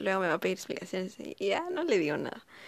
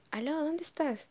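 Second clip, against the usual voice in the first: mamá al teléfono; 8 semitones higher than usual. Retold or imitated. Mamá llamando, voz imitada.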